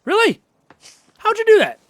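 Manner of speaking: very high, loud squeal; incredulous. Real, not acted. Baffled by the enemy's move, high incredulous squeal. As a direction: squawk it in disbelief.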